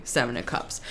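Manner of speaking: card name dropped low and quiet; murmured "Seven of Cups" said low and soft; soft low first take of the card name. Shown as a demonstration.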